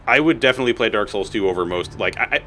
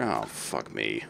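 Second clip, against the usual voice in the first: defeated; 12 semitones lower, 12 dB quieter, much slower than usual. Real, not acted. Muttered defeat.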